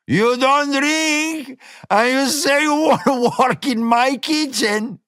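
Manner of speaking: high, slow, laughing shout of disbelief. A narrator's reading. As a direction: crow it out in mocking amusement. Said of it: "Gomez laughed at that".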